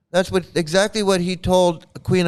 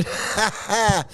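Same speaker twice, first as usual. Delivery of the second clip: short burst of chuckling; amused laugh; 3 semitones higher, 3 dB quieter than usual. Real, not acted. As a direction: break off into a quick laugh. Laughs while recalling the show.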